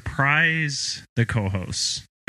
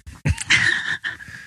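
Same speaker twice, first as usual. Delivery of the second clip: high squealing laugh. Real, not acted. Laugh burst.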